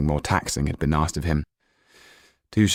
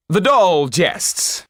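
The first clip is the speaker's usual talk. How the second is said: higher, louder, slow nervous cry; anxious cry; 12 semitones higher, 6 dB louder, much slower than usual. Acted. Topolain covering the doll's prophecy before the audience, higher and louder. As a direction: cover the blunder with a forced laugh-off. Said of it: "he cried".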